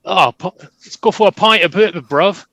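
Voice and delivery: a cocky English football lad asking you to the pub; English soccer hooligan calling for a pint. Pretend. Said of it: "like, the, soccer hooligan".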